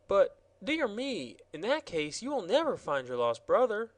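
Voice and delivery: put-on dear me, a girl alarmed; exclaiming girl. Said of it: "exclaimed the girl".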